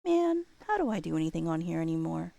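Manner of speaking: ordinary talk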